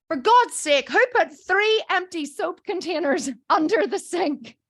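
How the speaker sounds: loud, high, indignant rant; exasperated colleague. Retold or imitated. Kim finding rubbish under the kitchen sink, loud exasperation. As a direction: imitate an irritated demand for the culprit. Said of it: "Kim comes in and starts pulling shit out".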